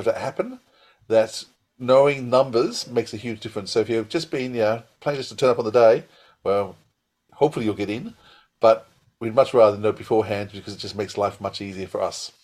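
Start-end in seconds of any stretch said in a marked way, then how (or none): none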